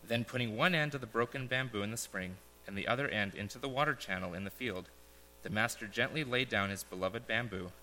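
solemn parable reading with pauses; story narration. Acted. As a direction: read it like a gentle fable to the congregation. Reads a parable aloud.

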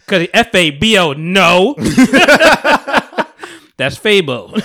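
laughing while spelling out letters. Shown as a demonstration. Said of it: "I don't even know how to spell fabulous correctly".

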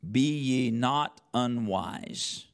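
ordinary talk, nothing marked

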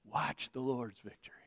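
very soft, low and reverent; hushed awe. Real, not acted. Preacher finishing his points to congregation, hushed. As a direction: drop to a hush.

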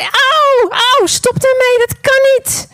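high, loud cry of pain, slowing. Acted. Vase in the hot oven begs the maker to stop, crying out high in pain. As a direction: yelp as if burning in the oven. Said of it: "En ik zei".

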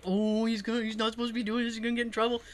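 put-on furtive kid. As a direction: say it under the breath. Kid furtively narrates dad's wrongdoing.

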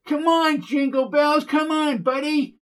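high-pitched frantic cheering; screaming cheer. A narrator's reading. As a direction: yell at the racing horse. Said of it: "began screaming for Jingle Bells".

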